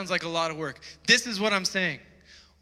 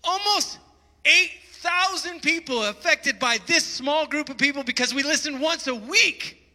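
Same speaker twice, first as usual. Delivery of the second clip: real excitement, pitched high and raised; excited yelling; 7 semitones higher than usual. Real, not acted. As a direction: yell it out, thrilled rather than mad. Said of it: "I'm yelling 'cause I'm excited".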